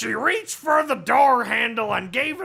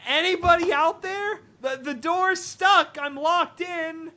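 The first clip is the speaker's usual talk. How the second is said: higher, anxious call for help; worried calling; 7 semitones higher than usual. Acted. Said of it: "she called".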